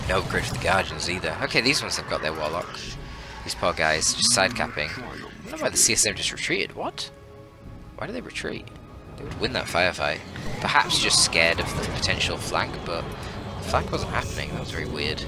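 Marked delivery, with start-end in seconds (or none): none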